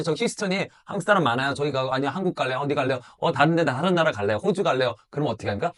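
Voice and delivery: normal talking voice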